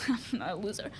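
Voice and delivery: low-volume whisper; whispered aside. Acted. Comic explaining why no bus, whispered aside.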